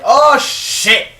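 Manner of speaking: sudden high, loud shout; startled yell. Real, not acted. Storyteller breaking off mid-sentence, real startled yell.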